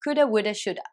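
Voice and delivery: "coulda、woulda、shoulda" with have cut to a; reduced forms. Shown as a demonstration. Teacher modelling "coulda、woulda、shoulda" reductions. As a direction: run each one together casually. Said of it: "shouldaになります".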